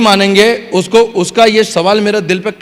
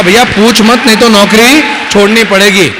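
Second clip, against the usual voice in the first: proud louder retort; 2 semitones higher, 5 dB louder than usual. Retold or imitated. Repeats the answer with more force.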